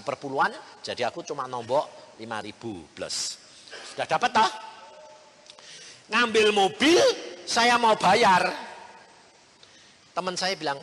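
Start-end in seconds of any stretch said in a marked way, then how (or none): none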